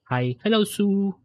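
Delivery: ordinary delivery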